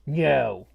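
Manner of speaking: "No" with a y slipped in after the n; Roy Kent. Retold or imitated. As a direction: say "No" like "nyo", southern London attempt. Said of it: "it's like a Y in there".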